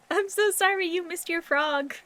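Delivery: ordinary talk, nothing marked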